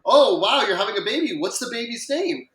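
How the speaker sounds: mock excitement. Dad playing along, pretend game.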